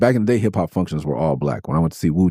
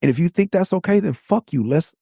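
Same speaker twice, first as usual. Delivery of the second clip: heated, high-pitched indignation; outraged rant; 12 semitones higher than usual. Real, not acted. Challenging anyone who defends the police punch, outraged and high-pitched. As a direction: argue in real anger, voice pitched way up.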